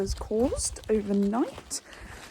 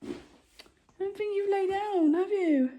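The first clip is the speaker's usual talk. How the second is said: teasing pet talk; 11 semitones higher than usual. Real, not acted. Teasing horse about lying down.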